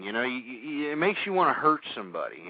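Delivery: ordinary talk, nothing marked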